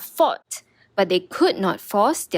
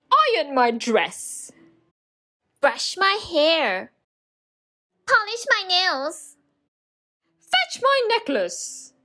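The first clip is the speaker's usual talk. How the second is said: bossy stepsisters; 7 semitones higher, slower than usual. A narrator's reading. Stepsisters bossing Cinderella.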